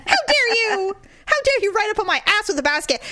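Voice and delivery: high, overblown scolding; hammed-up indignation. Shopper spraying the woman who rode up on her, mock fury. Acting out revenge on rude shopper.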